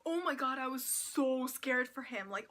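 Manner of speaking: ordinary talk, nothing marked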